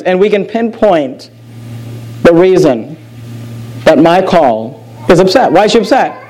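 ordinary talk, nothing marked

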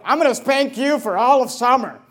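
loud, exaggerated punishment threat; mock parental threat. Mocked parent threatening endless spanking, loud and heated. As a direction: threaten loudly like an overreacting parent.

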